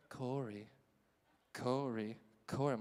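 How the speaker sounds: soft, low name-calling; hushed calling. Retold or imitated. Jamie calling her classmate in math class, hushed. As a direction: call the name under your breath.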